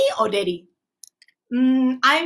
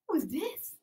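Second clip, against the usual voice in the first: usual speaking voice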